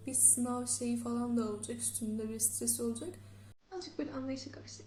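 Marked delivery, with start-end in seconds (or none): 3.7-4.8 whispering. Real, not acted. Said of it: "birazcık daha rahat konuşabilirdim".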